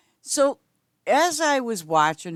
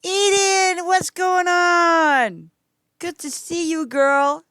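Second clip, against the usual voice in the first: much higher, louder, slow drawn-out hello; excited greeting; 11 semitones higher, 6 dB louder, much slower than usual. Real, not acted. Streamer greets player Eden joining the game, excited call. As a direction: sing out a delighted welcome.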